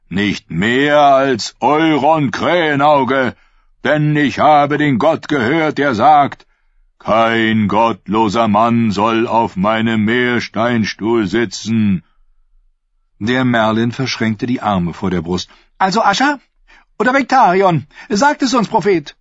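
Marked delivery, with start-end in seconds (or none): 0.1-12.0 high, loud, slow sermon to the crowd; preaching prophet. Acted. 15.8-19.1 much higher, pointed voice of the lord; lord's mocking challenge.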